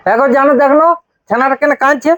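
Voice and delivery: loud, high-pitched call. Acted. Calls out about crying child. (চড়া গলায় হাঁক দিয়ে ডাকা)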